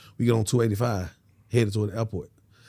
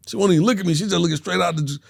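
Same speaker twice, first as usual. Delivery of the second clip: ordinary delivery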